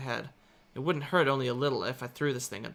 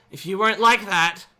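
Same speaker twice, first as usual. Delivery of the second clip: louder, bitter accusation; angry outburst; 7 semitones higher, 12 dB louder than usual. A narrator's reading. Angry girl blaming crying classmate. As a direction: spit the blame out.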